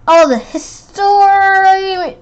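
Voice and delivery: slow, high swooning awe; gushing teacher. Acted. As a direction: swoon over the history. Teacher gushing over ruins.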